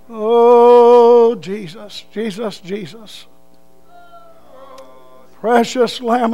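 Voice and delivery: slow, drawn-out pleading prayer with loud cries; fervent prayer. Acted. Preacher leads the congregation in prayer, fervent and drawn out. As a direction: pour it out to God, crying out.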